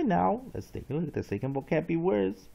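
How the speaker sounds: normal talking voice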